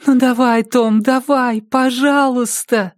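put-on high, urgent girlish plea; young fan pleading at high pitch. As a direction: beg him in a high voice, stretching the last word. Girl begs the pitcher to succeed.

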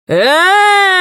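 high, sustained drawn-out cry; long held shout from another voice; drawn-out call. A narrator's reading. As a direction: stretch the call out and hold the pitch up. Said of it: "протянул другой голос".

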